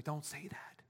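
whispered, superstitious hush; hushed warning. Retold or imitated. Someone hushing the preacher for admitting a cold, whispered. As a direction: breathe it like a jinx warning. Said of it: "and someone says to me".

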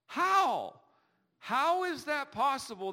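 high, loud astonished how; disbelieving cry; incredulous. Real, not acted. Preacher asking the congregation in disbelief, astonished. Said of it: "rhetorical exclamation".

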